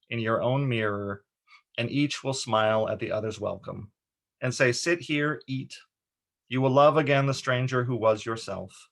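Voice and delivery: measured, expressive poem recital; poem reading. Acted. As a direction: read the poem line by line, with deliberate pauses. Reads an adapted poem.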